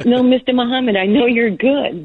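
high-pitched, insistent Queens mom. Retold or imitated. Mother correcting Ali about who is greatest, imitated insistent mom.

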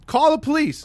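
high, loud exasperated call; exasperated shout. Real, not acted. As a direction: yell it in disbelief. Exasperated outburst at story.

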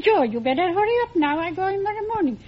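normal talking voice

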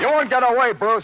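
furious pursuit shout; chasing shout. Acted. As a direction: yell it after the fleeing man. Detective chasing the killer.

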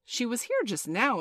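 high-pitched, mildly impatient reply; a little impatient. A narrator's reading. Peter hunts for Tink as Wendy marvels, a little impatient. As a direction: answer with a touch of impatience. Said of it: "he said a little impatiently".

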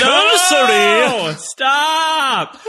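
high laughing protest; laughing shout. Real, not acted. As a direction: yell it, laughing. Teased about the Count.

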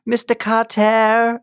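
high, drawn-out character impression; Horshack. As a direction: stretch out a high-pitched sitcom catchphrase. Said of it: "That was my Horseshack impression".

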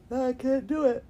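words stretched by a real yawn. Real, not acted. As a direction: talk through a yawn you can't hold back. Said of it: "I'm fighting a yawn".

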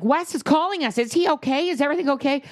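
high, worried retold questions; alarmed in-laws.

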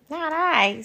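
high squeaky pig voice; squealing pig. Acted. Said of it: "squealed the pig".

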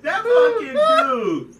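loud, high, drawn-out words through laughter; cracking up. Real, not acted. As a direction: wail it out slowly, helpless with laughter. Still cracking up over the scene.

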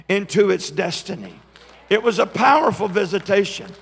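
loud, high preaching, building with fervour; preaching at full force. Real, not acted. Preacher to church, rising into loud, high full-force preaching. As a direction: preach it out to the congregation with rising power.

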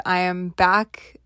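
ordinary talk

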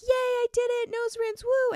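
put-on very high excited little-kid cheer; toddler voice. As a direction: squeal it like a proud toddler. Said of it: "He's like".

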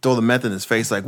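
normal talking voice

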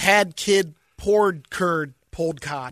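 slow, careful word by word; too slow. Real, not acted. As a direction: read it out slowly and carefully. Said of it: "You gotta go a little faster".

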